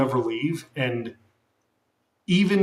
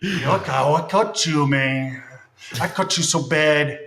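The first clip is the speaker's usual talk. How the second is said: hammed-up Mexican gangster accent; mock Mexican threat. Streamer pretending to threaten co-host, mock Mexican accent.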